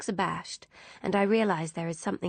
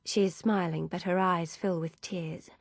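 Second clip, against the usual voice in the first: ordinary delivery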